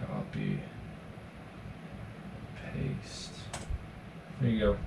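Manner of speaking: very quiet muttering under his breath; muttering to himself. Real, not acted. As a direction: mumble it to yourself while working. Muttering to himself while typing.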